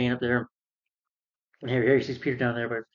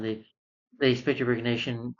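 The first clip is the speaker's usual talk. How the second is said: ordinary delivery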